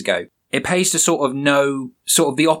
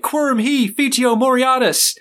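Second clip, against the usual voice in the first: much higher, put-on cartoon voice reciting Latin; Mordecai speaking Latin; 11 semitones higher than usual. Host voicing Mordecai's Latin line, much higher cartoon voice. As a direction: pitch it up like a cartoon bird declaiming. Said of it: "Mordecai's like".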